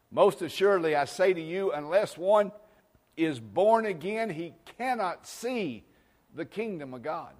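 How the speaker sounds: loud, high, fervent preacher cry; full-force preaching. Acted. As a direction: proclaim it like thunder from the pulpit.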